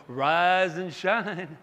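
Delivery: slow, drawn-out sing-song call; slow tender wake-up call; mom's gentle wake-up. Retold or imitated. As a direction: stretch it out like a loving mother waking a child. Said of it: "my mom walking in going".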